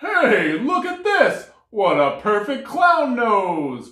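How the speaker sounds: loud, delighted bear voice. A narrator's reading. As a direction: boom it like a happy bear. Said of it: "cried the bear".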